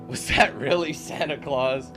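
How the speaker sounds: ordinary talk, nothing marked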